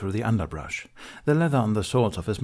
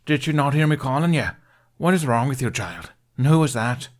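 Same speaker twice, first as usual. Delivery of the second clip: loud, raised voice of a scolding mother; 6 semitones higher, 5 dB louder than usual. A narrator's reading. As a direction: tell off the child loudly.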